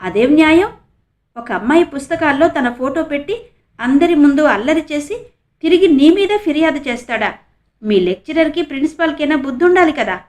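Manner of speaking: angry. A narrator's reading. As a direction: rage at the injustice. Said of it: "కోపంగానే అన్నాడు ఆనంద్".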